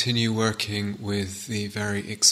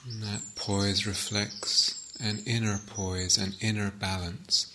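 unhurried, softer phrases left to settle in silence; guided meditation; 6 dB quieter than usual. Acted.

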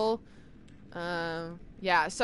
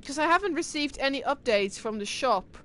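ordinary delivery

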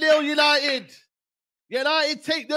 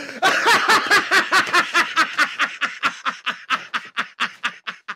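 long helpless laughter; 2 semitones lower than usual. Real, not acted. Fan cracking up at the goal.

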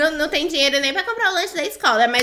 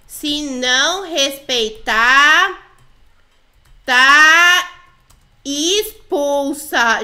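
"Se não respeitar, tá expulsa" very drawn out, with pauses and loud "tá"; slow warning; 6 dB louder, much slower than usual. Pretend. Player types a threat to the girls, "Se não respeitar, tá expulsa", slowly dictated.

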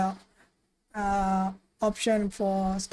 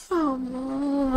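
yawning; 4 semitones higher, 4 dB quieter than usual. Real, not acted. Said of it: "Why am I yawning?".

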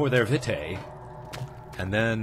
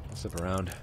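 sneaky hush; 4 semitones lower, 8 dB quieter than usual. Real, not acted. Sneaking past enemy.

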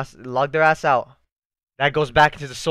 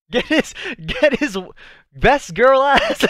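high, giddy crowing; excited cheering; 10 semitones higher, 5 dB louder, slower than usual. Real, not acted. Fan cheering as best girl arrives, excited high voice. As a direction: crow it out, louder toward the end.

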